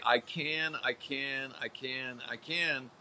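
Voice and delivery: slow, softening "I can" chant; fading "I can". Shown as a demonstration. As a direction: let each "I can" drop away quietly. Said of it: "Say it like you really believe it".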